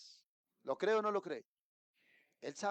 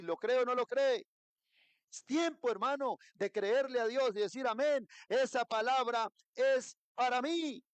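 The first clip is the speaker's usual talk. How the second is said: rousing preaching; 6 semitones higher, 4 dB louder than usual. Real, not acted. Preacher rouses congregation, climax.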